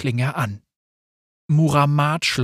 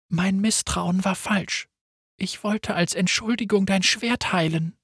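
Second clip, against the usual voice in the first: soft apology; 6 semitones higher, 4 dB quieter than usual. Acted. Apologetic confession.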